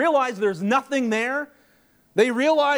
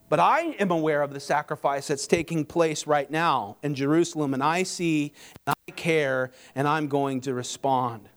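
low, subdued voice of God; God speaking; 8 semitones lower than usual. Acted. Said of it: "as if God's saying".

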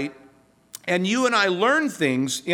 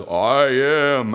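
lower, weighty declaration; voice of God; 6 semitones lower, 4 dB louder than usual. Acted. Preacher quoting Jesus at sea, low solemn God voice. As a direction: intone it low like a divine pronouncement. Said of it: "very words that God spoke".

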